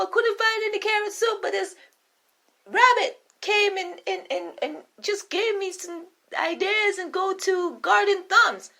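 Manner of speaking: jittery cook in a squeaky high voice; nervous cook. Acted. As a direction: stammer excuses to your queen. Cook explains to the queen.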